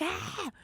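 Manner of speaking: short high shout, pitch jumping up; a quick high "Ah!" like hyping a class; mock hype yell. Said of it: "I'm always like".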